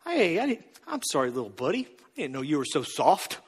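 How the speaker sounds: put-on low, soft, patronising coo as if to a small child; condescending fake apology. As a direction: talk down to them like a little buddy, chuckling. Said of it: "what you're actually doing is being like".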